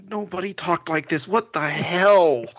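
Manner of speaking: retold shock at fake street-racer talk; incredulous. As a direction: drag out "what the hell" in mock disbelief. Quoting his own incredulous reaction.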